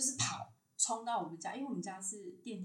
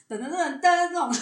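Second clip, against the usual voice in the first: sung-out ta-da fanfare, higher and much louder; fanfare imitation; 9 semitones higher, 15 dB louder than usual. Recalling the god's entrance, she imitates the fanfare played, loud and high. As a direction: mimic a victory jingle brightly.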